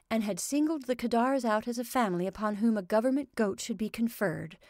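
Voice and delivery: ordinary delivery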